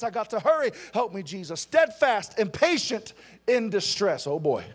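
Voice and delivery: ordinary talk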